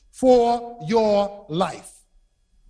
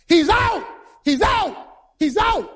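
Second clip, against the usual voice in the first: high-pitched insistent shouts; team shouting; 8 semitones higher than usual. Acted. Visiting team runs out disputing the call, shouting. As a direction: holler the call as a rival team. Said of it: "One team is shouting".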